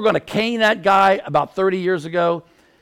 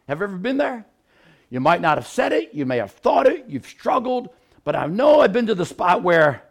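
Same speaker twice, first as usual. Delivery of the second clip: ordinary talk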